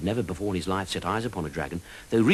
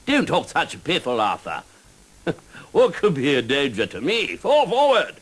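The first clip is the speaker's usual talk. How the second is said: higher, louder pompous knight; boastful knight; 6 semitones higher, 6 dB louder than usual. Acted. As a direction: bluster like a proud fool.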